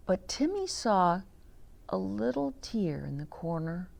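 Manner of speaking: gentle read-aloud storytelling voice; story narration. A narrator's reading. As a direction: read it to the grandchildren, unhurried. Reading the picture book aloud.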